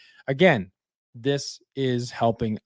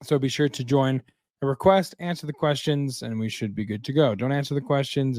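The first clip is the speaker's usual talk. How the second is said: usual speaking voice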